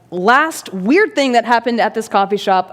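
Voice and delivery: ordinary delivery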